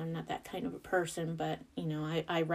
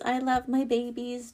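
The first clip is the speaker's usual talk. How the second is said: sincere reassurance after the gush; softer sincere repeat; 6 semitones higher, 4 dB louder than usual. Real, not acted. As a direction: come back down and mean it. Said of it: "Don't get me wrong".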